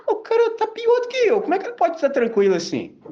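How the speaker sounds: laughing at the calm guy; laughing disbelief. Retold or imitated. Max thinking about his calm friend, laughing in disbelief. Max's amused, baffled thought.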